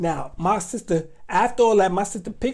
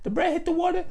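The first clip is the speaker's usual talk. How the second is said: put-on higher child voice, rising; little boy tattling, pitched up; 9 semitones higher, faster than usual. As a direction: tell it like a kid snitching. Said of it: "retold as child snitching".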